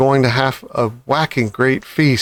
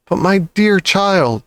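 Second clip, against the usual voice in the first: cried; 6 semitones higher than usual. A narrator's reading. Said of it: "she cried".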